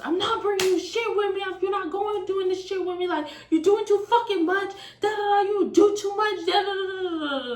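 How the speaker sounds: very high mock ranting; angry rant. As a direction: imitate his tirade, high and insistent, into babble. Boyfriend rants at her.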